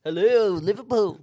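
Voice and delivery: mock Liverpool accent. Friend plays a Beatle greeting the crowd, in a mock Liverpool accent. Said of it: "Very Beatles".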